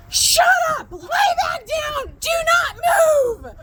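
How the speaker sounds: very high, screeching mock shout; retold yelled order. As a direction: re-enact yelling frantic orders at an injured parent. Retold yelling at injured dad.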